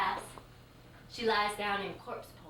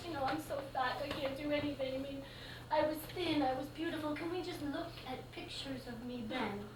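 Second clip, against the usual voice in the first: higher, mock-tearful whine; imitating her crying; 5 semitones higher, 4 dB quieter than usual. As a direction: play a lightly voiced weepy student. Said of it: "she starts crying".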